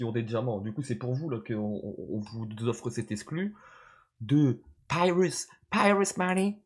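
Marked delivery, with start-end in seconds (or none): 4.2-6.6 put-on English accent. Streamer to chat, announcing the rapper: put-on English accent. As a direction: hype the artist's name high in mock English. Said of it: "oh, l'accent là".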